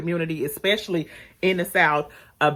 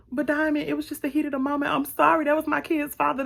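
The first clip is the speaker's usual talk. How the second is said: pleading; 8 semitones higher than usual. Retold or imitated. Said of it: "And she like".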